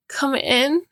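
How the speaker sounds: put-on high begging child voice; a small boy pleading to be let in; pleading toddler. Toddler pleading with his mother at the door, high child voice. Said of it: "Jack pleads".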